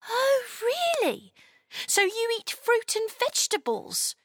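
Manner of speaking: put-on high-pitched, wondering girl; high, intrigued child voice; curious little girl. A narrator's reading.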